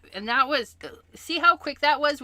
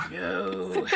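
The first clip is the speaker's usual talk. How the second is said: gasp and laugh; 2 semitones lower than usual. Real, not acted. Reacting to own idea.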